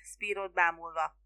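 plain reading voice

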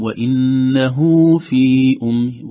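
وإنه very slow with a small waw after the ha; slow recitation. Shown as a demonstration. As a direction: add the silah, وإنهو في أم. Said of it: "ننتبه لكلمة وإنه".

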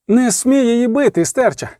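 high-pitched, understated frightened protest; frightened cry. A narrator's reading. Lover begging brothers not to hit her, frightened cry. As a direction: cry out in fear, lightly acted. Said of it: "закричав Рейневан голосом, що ламався і тремтів від переляку".

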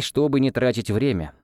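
ordinary delivery, nothing marked

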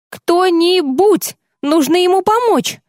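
high, strained, slow alarmed cry; strangely changed voice. Acted. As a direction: voice gone strange with shock. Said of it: "Странно изменившийся голос Парами".

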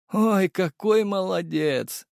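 high, slow, sugary mock praise; mocking villain. A narrator's reading. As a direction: coo at him like a grandma.

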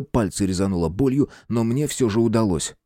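ordinary delivery, nothing marked

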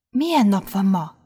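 ordinary delivery, nothing marked

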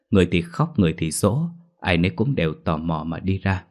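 plain reading voice

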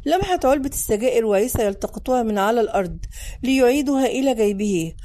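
ordinary delivery, nothing marked

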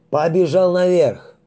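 high, loud barked command; barked order. Acted. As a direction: snap the order like a drill sergeant. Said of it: "рявкнул лейтенант". (рявкнуть приказ)

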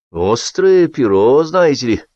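ordinary delivery

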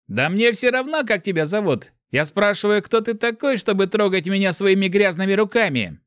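high, heated angry tirade; furious outburst. A narrator's reading. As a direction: rage at him with the pitch held high. Enraged boy keeps confronting the stranger.

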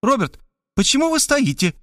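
high, impatient question; woman impatient for Robert to help. A narrator's reading. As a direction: hurry him up. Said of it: "нетерпеливо спросила она".

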